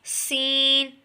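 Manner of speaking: सीन with a whistle-like s, long held; whistling sibilant. Shown as a demonstration. Teacher showing a whistle-like letter, whistling sibilant. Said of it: "इस सीटी की तरह आवाज़ निकले".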